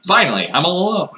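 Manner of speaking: loud, high, hammed-up relief; mock relief. Player once the lights are off, mock relief. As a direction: burst out as if set free.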